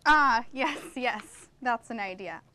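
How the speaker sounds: ordinary talk, nothing marked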